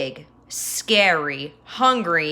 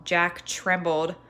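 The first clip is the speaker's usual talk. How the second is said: ordinary delivery, nothing marked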